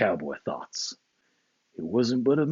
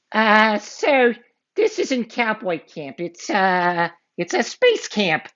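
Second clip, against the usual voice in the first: put-on high, hesitant little boy fibbing; nervous kid voice; 11 semitones higher, slower than usual. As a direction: stall and bluff nervously. Avery bluffing.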